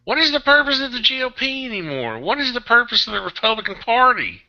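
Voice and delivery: high, insistent, exasperated questioning; exasperated demand. Real, not acted. Host demanding the Republican Party's purpose, exasperated. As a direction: pitch way up, pressing the question in frustration.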